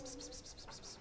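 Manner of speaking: soft unvoiced pss calls like calling a cat; whispered pss. Reading a bumper sticker aloud, whispered cat-calling pss sounds. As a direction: breathe out pss pss to call a cat. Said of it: "I said pss".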